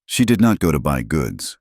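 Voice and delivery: plain reading voice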